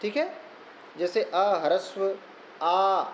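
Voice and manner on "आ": आ held for two beats; long aa. Shown as a demonstration. Teacher showing the class the long आ. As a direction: stretch आ to a two-beat vowel. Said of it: "दीर्घ".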